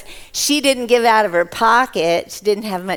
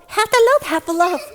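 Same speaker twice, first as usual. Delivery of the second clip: amazed repeat; 8 semitones higher, 3 dB louder, faster than usual. Acted. Astonished repeat of the answer.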